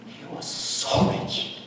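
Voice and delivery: whispered, slow and low; hushed. Retold or imitated. Old widow telling the pastor about her suitor, whispered. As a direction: breathe it out like a secret.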